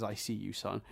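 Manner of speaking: a hushed, sarcastic I see you; mock the sentimental line under the breath; quiet mock tender line. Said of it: "when he says".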